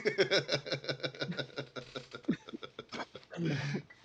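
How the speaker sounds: long high laugh; game master laughing at his own gnome voice. Real, not acted. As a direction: crack up mid-scene.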